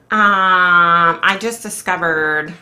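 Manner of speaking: ordinary talk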